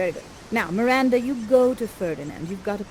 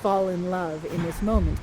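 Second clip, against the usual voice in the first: ordinary talk, nothing marked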